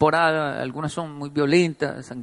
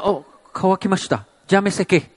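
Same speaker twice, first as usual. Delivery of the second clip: mock Japanese exit line; 2 semitones higher, 4 dB louder than usual. Friend's parting line, Japanese.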